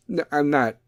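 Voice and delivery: normal talking voice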